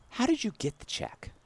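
lightly voiced suspicious manager; a hint of a prim manager asking; mock manager.

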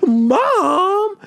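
high yelled call of Mom. Retold or imitated. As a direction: shout for mom across the room. Kid calling his mom.

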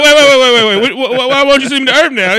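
loud, high, stammering mock protest; frantic interruption. Banished son stopping Odin mid-sentence, frantic protest. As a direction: burst in with a rapid-fire string of waits.

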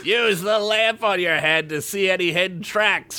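high commanding voice. Acted. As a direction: give the order sharply. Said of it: "she commanded".

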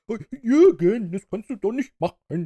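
put-on appalled children's host calling after him; Erklärbär. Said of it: "Erklärbär seinem Co-Moderator Jürgen entsetzt hinterherrennt".

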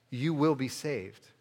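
usual speaking voice

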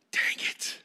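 whispered curse to oneself; inner grumble. Acted. Kid silently cursing having to get up, hushed grumble. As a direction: mutter it under your breath, softly. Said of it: "in your heart, you're like".

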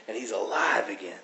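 soft whispered wonder; whispered awe at the return; hushed. Acted. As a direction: breathe it out softly. Hushed close of the parable.

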